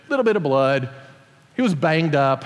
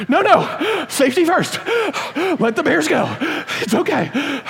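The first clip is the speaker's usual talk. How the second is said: mock cheerful; 10 semitones higher than usual. Relieved to rest, mock virtuous.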